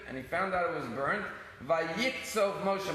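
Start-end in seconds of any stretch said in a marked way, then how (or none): 1.6-2.9 solemn verse quote. Shown as a demonstration. Quoting the Hebrew verse.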